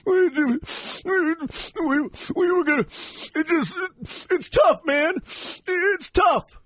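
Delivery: whiny fake crying voice; mock sniffling. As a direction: sniffle through it like a sore loser. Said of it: "All sniffling".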